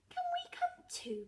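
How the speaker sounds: very high little piglet voice; little Peppa. A narrator's reading. Peppa asks to join her grandparents, little-piglet voice. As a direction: ask in a high, childlike pitch.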